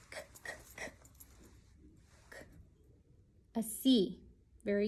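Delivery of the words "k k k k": "K, k, k, k" as soft unvoiced k-sound puffs; letter sound. Shown as a demonstration. Teacher sounding out the first letter for kids, unvoiced. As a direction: breathe out the "k" sound, no voice. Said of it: "Computer starts with what letter?".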